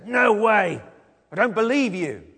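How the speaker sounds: loud, high, flat-out refusal; angry disbelief. Acted. Thomas answering the excited disciples, angry and sceptical. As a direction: throw their news back at them forcefully. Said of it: "Maybe even anger in his words".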